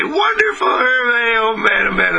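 high, gushing mock enthusiasm, easing down; imagined reply full of exaggerated joy; acted delight. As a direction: burst out high as if overjoyed.

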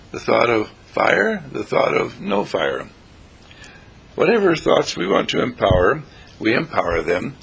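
usual speaking voice

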